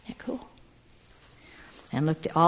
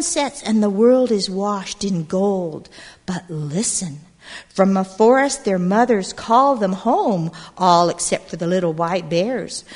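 put-on lively read-aloud to a group of kids; storybook narration; 2 semitones higher, 7 dB louder than usual. A narrator's reading. Storyteller reading to gathered children, lively narration. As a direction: tell it like a picture book at storytime. Reads picture book aloud.

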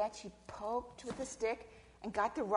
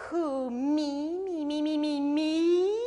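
higher, louder sing-song "me, me, me"; feigned innocence; 6 semitones higher, 7 dB louder, much slower than usual. Acted. Snake asked once more about biting, loud innocent protest. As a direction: protest with stretched, wobbling innocence.

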